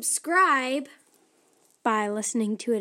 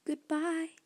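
soft echo from far away; faint voice echoing up from earth; 4 semitones higher, 7 dB quieter than usual. Acted. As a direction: sound distant and small. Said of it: "The faint voice of Biddy".

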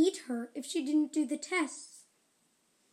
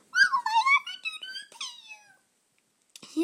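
little ant voice; 7 dB louder than usual. Acted. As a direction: thank her like a tiny ant. Ant voice continues.

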